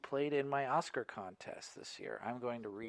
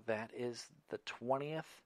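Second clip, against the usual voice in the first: ordinary delivery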